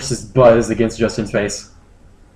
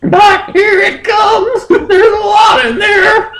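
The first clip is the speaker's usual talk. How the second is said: very high falsetto, drawn out; squeaky creature yelling slowly; high Moltres voice, slow and shouted; 6 dB louder, slower than usual. Acted. Moltres warning Justin, high and slow.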